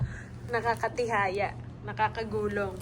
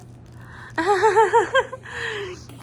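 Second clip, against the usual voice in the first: loud, high giggling laugh; amused laugh; 8 semitones higher, 5 dB louder than usual. Real, not acted. Mother amused while teaching baby a word, high loud laugh.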